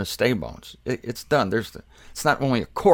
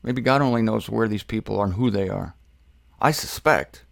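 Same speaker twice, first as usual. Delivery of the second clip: ordinary talk, nothing marked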